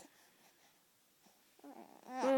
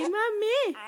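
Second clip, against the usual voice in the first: Mommy said higher, clearly, falling at the end; high sing-song model word; 6 semitones higher than usual. Shown as a demonstration. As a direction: model Mommy for a baby, lifted and slow.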